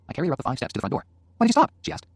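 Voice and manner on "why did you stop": high, loud question; challenging wife. Acted. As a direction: demand why, pitched high. Wife challenges husband carrying her.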